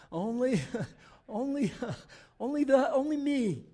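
hammed-up whine of "only me"; whining, laughing. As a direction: overplay the self-pity until it cracks up. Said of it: "he's whining".

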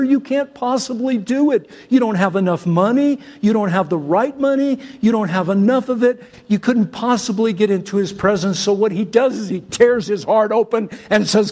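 usual speaking voice

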